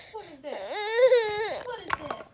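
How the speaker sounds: high, hammed-up mock whimper; playful fake sobbing at the baby; mock crying. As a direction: copy the baby's cry, overdone.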